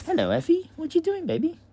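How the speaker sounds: very high, sing-song coo at the puppy; high cooing pet voice. Real, not acted. Talking baby talk to puppy.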